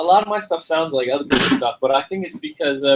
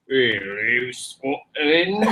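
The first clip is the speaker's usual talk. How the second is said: put-on lower, deeper Scottish accent take; 4 semitones lower, 4 dB quieter, much slower than usual. Told to go deeper, a lower Scottish accent. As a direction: drop it low like the Scottish hills. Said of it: "it's a little deeper".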